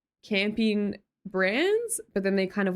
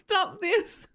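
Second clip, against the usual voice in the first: laughing protest; 12 semitones higher than usual. Real, not acted. Laughing protest at chat's teasing.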